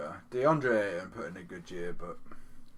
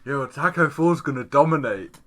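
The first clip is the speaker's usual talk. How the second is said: excited; 6 semitones higher, 14 dB louder than usual. Real, not acted. Laughing, hyping up the player.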